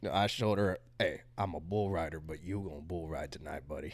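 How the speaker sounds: low, quiet bull rider swagger; deep cowboy voice. Acted. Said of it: "It's hard to go back to normal voice than this".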